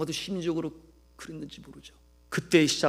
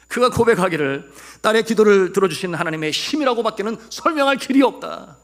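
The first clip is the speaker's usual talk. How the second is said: high, loud pulpit proclamation; full-force preaching; 8 semitones higher, 12 dB louder than usual. Real, not acted. Preacher quoting actress crediting God, full-force preaching. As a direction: declare the testimony with conviction.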